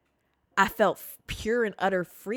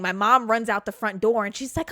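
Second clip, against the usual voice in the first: ordinary delivery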